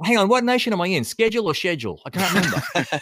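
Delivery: hammed-up bewilderment; mock confusion. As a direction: act thrown, as if lost between two countries. Acts own confusion over accents.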